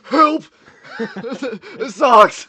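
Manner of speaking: loud shout breaking into laughter; laughing shout. Acted. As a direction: yell for help, cracking up. Improv, suffocating astronaut.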